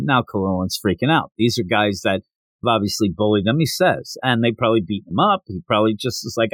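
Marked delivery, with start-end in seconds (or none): none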